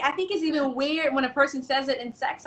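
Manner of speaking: ordinary delivery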